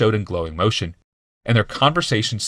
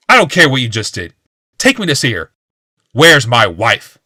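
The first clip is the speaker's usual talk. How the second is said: furious demand; 4 semitones higher, 8 dB louder than usual. A narrator's reading.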